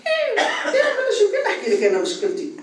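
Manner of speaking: high, scornful mock voice with a laugh; mocking dismissive listener. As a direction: mimic a condescending woman belittling a problem. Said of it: "ساعات تعتبر".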